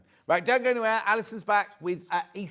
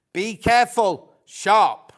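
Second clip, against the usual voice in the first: loud warning; 4 semitones higher, 12 dB louder, slower than usual. Real, not acted. Warning viewers about sharp blades.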